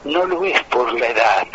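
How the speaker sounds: ordinary delivery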